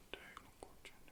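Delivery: barely-there unvoiced whisper. Optician repeating the test area during an exam, whispered. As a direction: whisper it softly, as if to yourself.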